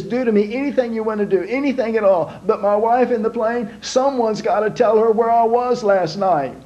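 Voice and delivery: high, fast, frantic begging; desperate pleading. Acted. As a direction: panicky husband pleading for mercy. Pilot begging the MPs.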